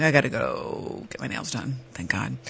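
a soft, dropping mutter; muttered aside. Retold or imitated. Podcaster retelling fleeing to the nail salon, muttered. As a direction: mumble it to yourself as you leave. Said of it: "I'm like".

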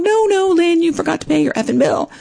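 high-pitched mock telling-off; mock self-scolding. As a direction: scold yourself in a high, teasing voice. Scolding herself in a retold scene.

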